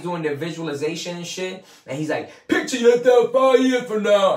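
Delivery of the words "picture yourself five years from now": much higher and louder, a booming hammed-up seminar coach; Tony Robbins impression. Mimicking the speaker leading the crowd's visualization, a loud high mock guru voice. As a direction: belt it like a motivational guru onstage. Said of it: "I can't do the Tony Robbins voice".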